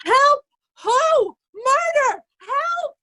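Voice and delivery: high, frantic calls for help; panicked cry for help. Acted. Oswald fleeing Kent's sword, panicked high cries. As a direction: scream for rescue as he flees.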